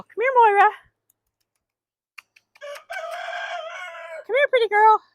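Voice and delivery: loud high sing-song call across the yard; calling the hen. Real, not acted. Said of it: "I'm calling her".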